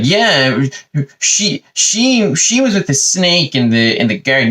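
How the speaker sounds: high, stammered, drawn-out blaming; mock wimp telling on someone; wimpy tattletale. Adam telling God on Eve, high and wimpy. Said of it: "wimpy little bitch that he is".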